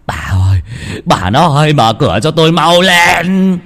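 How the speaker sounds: panicked high shout to his wife; frightened husband banging on the door, shouting; frantic calling. Acted. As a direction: yell for her to open up, terrified. Husband pounding door, terrified.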